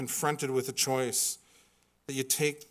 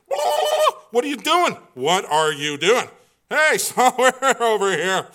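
high, loud panicked outburst; hammed-up alarm, starting with a high whoop; mock freak-out; 8 semitones higher, 8 dB louder than usual. David's men as he gives away their position, freaking out. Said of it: "They're freaking out. They're just like".

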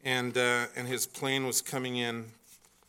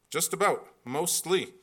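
normal talking voice

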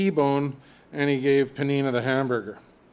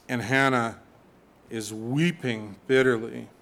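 ordinary talk

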